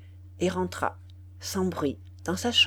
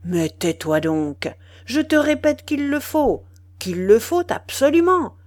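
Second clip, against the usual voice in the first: angry; 5 semitones higher, 7 dB louder than usual. Acted. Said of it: "Il finit par se fâcher".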